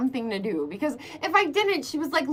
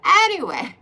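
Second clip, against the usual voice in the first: ordinary talk, nothing marked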